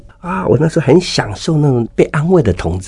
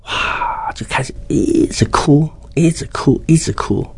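tearful whisper; 4 semitones higher than usual. Retold or imitated.

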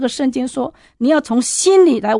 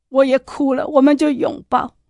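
ordinary talk

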